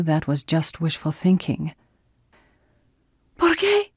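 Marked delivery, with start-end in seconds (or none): 3.4-3.9 contained narrator's scream, pitched way up. A narrator's reading. Neighbour screaming at her boyfriend, high contained scream. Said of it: "Anna screamed".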